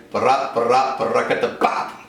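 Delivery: scat the rudiment, fading into breath; vocal drum sounds. Shown as a demonstration. Drum teacher scatting a rudiment for students. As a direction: mouth the drum pattern.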